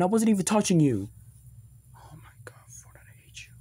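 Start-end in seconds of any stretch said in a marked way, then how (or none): 2.0-3.5 hushed annoyed whisper; muttered whisper of disgust. Acted. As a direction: breathe it so he can't hear. Annoyed aside to himself.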